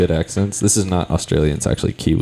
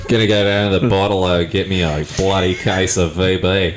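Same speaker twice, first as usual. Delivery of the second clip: mock Australian accent. Joking on a podcast, a light mock Australian accent. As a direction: play an Aussie bloke fetching beer in a light Australian accent. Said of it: "I do a great Australian accent".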